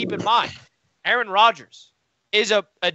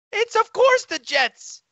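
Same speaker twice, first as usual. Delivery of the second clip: higher, gleeful gloating at a rival fan; 11 semitones higher than usual. Real, not acted. Jets fan gloating at his rival cohost, high and gleeful. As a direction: rub it in, delighted with yourself.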